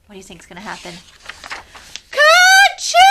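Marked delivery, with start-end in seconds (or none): 2.1-3.0 loud high sneeze; big sneeze. A narrator's reading. Sleeping bear sneezing from the pepper. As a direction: blast out a huge sneeze. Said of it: "makes the bear say".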